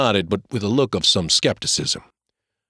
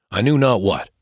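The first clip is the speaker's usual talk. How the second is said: plain reading voice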